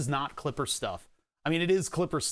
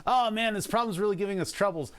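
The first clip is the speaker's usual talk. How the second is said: normal talking voice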